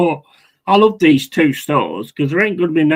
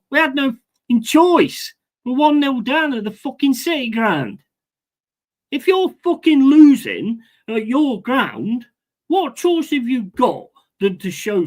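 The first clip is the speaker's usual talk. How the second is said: high-pitched furious venting; angry rant; 9 semitones higher than usual. Real, not acted. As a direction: let the outrage out. Fan raging after home loss.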